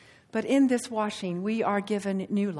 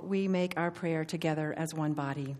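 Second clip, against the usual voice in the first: normal talking voice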